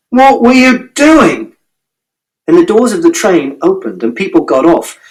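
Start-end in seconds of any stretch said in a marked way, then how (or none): 0.1-1.5 high, slow, pressing question; man scolding the boy after pulling him off the tracks, slow and high; exasperated demand. Acted. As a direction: drag it out in disbelief. Stranger demands an answer.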